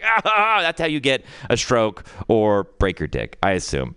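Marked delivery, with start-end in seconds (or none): 0.0-0.6 straining grunts. Retold or imitated. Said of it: "I was like".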